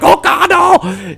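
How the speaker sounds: high, drawn-out comic cry of dismay; mock panicked cry. Retelling the doomed sidekick's fall, mock panicked cry. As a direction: wail it as the falling character. Voices Benny falling, crying out.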